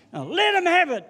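high, loud excited storytelling; excited telling. Real, not acted. Preacher telling how he struck the figure, excited and loud. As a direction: build it up, pitched high and loud.

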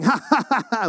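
high mocking ha-ha laugh, imitated; Jabba the Hutt laugh. Possessed guy laughing at the pastor, mock laugh. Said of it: "Jabba the Hutt laugh".

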